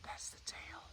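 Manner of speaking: soft real whisper; whispered aside behind the camera. Real, not acted. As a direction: hush so you don't disturb it. Whispering while filming the snake.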